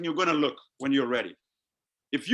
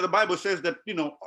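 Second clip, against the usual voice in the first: ordinary talk, nothing marked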